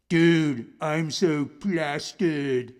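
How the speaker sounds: put-on slow slurry drunk voice; drunk wall. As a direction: slur like a drunk buddy. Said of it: "it would say".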